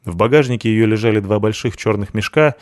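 plain reading voice

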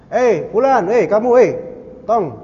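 mock-hail someone by name from afar; imitated calling out. Muslims hailing a comrade turned unbeliever, calling out.

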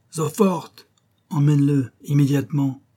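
officer ordering the sergeant; barked orders. Acted. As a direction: hammer out each order. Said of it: "ordonna-t-il à l'adjudant".

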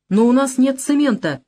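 understated desperate appeal; desperate exclamation. A narrator's reading. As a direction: plead in mild despair. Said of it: "с отчаянием воскликнул я".

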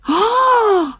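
high-pitched startled scream; short, very high shriek; startled yell. A narrator's reading. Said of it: "заорала я".

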